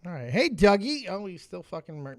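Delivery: ordinary delivery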